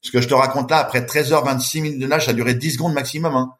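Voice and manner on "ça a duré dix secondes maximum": ordinary delivery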